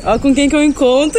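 high, quick, laughing; thrilled to bump into him; excited. Real, not acted. Surprise meeting a friend.

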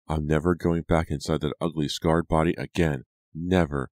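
ordinary delivery, nothing marked

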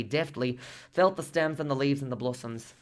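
plain reading voice

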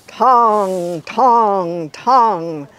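ringing bell tongs, loud and drawn out; booming bell sounds; bell imitation. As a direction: chime each tong like a big mission bell.

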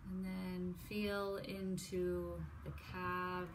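unhurried, soft, even meditation cueing; guided meditation. Real, not acted. Leads a body scan meditation.

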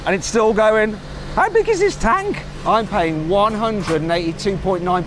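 rising alarm at the climbing price; shocked disbelief. Real, not acted. Shocked at fuel pump total.

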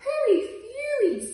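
scoffing dog. Acted. Spotty dog brushing off the cat, high and loud. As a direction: yelp it dismissively. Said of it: "said the spotty dog".

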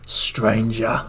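low mock Cockney call; Cockney merchant impression. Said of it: "He sounds like a Cockney Londoner".

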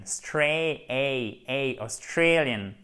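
"Stray" isolated, ay repeated, then whole "Australian"; diphthong drill. Shown as a demonstration. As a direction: break out the stressed ay of "Australian" slowly. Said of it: "vous faites bien l'accent tonique ici avec une belle diphtongue". (« Australian » décomposé, diphtongue « ey » appuyée)

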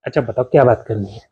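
ordinary delivery

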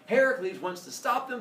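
plain reading voice

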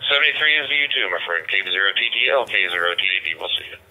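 normal talking voice

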